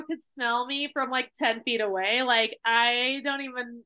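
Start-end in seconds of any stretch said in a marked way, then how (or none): none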